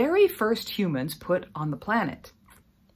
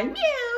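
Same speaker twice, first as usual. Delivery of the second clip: very high, cute meow; kitty meow. Shown as a demonstration. Teacher singing with kids, voicing the kitty with a cute meow. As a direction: mew like a little kitten. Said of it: "the kitties will be meow".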